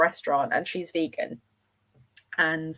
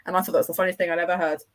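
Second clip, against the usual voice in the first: ordinary talk, nothing marked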